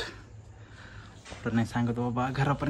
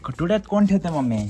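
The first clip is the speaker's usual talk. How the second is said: exasperated call; 7 semitones higher, 8 dB louder than usual. Real, not acted. Arguing with mom.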